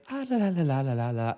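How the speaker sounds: high taunting la la la, dropping down; childish taunt, fingers in ears; mock la-la singsong. Mocking opponents' denial.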